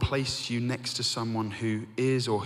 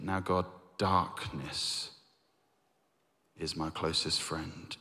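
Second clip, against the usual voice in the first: slow, softer, heavy with despair; slow bleak reading; 6 semitones lower, 6 dB quieter, slower than usual. Acted. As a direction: let each word sink, slow and soft. Quoting psalm's last line.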